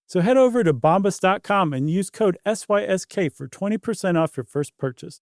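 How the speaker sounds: upbeat sponsor read. Acted. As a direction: sell it like a podcast ad.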